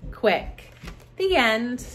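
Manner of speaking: normal talking voice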